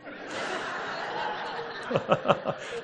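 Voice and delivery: a real delighted airy laugh; real breathy laugh. Real, not acted. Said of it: "Wow, I like that".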